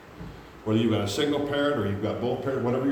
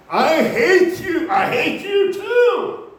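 high, loud, drawn-out shouts; hammed-up couple screaming at each other; acted furious quarrel; 8 dB louder, much slower than usual. Acted quarrel of young married couple.

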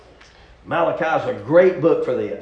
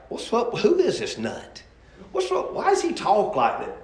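lightly voiced baffled onlooker, higher and soft; baffled co-worker; 6 semitones higher, 5 dB quieter than usual. Co-workers reacting to a preacher's put-on talk, baffled. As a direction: act out a puzzled workmate, under full voice.

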